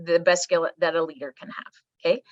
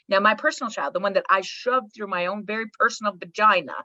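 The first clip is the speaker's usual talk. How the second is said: normal talking voice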